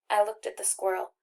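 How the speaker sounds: usual speaking voice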